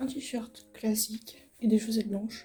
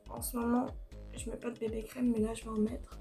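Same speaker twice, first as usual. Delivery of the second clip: quiet; 8 dB quieter than usual. Real, not acted. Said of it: "J'évite de parler trop fort".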